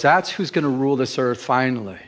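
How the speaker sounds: normal talking voice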